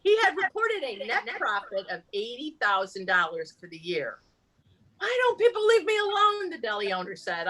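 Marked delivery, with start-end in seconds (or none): 5.0-6.5 high-pitched acted grievance; exasperated complaint. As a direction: read it with feeling as the put-upon deli owner. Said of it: "I'll read it with feeling".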